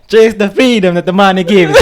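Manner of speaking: loud, high mock Italian shout; Italian accent. Shown as a demonstration. Finishing the money tip on request, hammed-up Italian accent. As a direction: proclaim it like an exaggerated Italian. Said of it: "Can you do Italian?".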